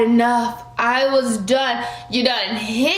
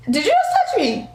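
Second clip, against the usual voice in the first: incredulous; 5 semitones higher than usual. Retold or imitated. Confronting roommate who slapped hand.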